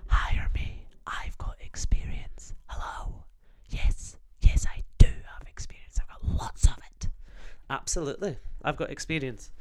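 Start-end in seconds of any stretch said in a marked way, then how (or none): 0.1-7.1 secretive stage whisper. Shown as a demonstration. Pitching to the interviewer, stage whisper. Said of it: "if you just whisper".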